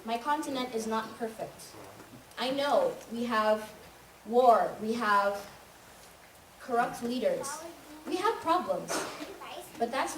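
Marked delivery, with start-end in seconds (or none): none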